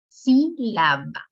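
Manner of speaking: sílaba very slow, broken into SÍ, LA, BA; syllable by syllable. Shown as a demonstration. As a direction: separate the three beats of sílaba, strongest first. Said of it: "tres sílabas". (sílaba dicha lentamente, separando sus tres sílabas)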